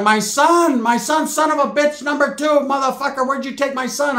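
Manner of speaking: high, loud imitated tirade; mock ranting aunt. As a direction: act out an aunt going off on someone. Tia's angry outburst, retold.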